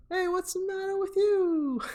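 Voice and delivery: very high, squeaky put-on voice; Jay Baruchel. Man in bed with her as she panics, high caricature voice. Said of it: "you hear Jay Baruchel be like".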